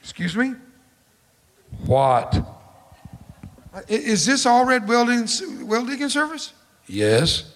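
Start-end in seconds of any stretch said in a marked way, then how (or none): 1.8-2.3 gruff answer. Acted. Gruff well digger answers phone. 3.7-6.5 timid caller. Retold or imitated. Caller unsure on the phone. 6.9-7.4 terse gruff reply. Acted. Terse well digger on phone.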